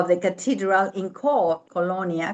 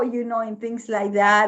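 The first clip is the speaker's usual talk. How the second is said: ordinary delivery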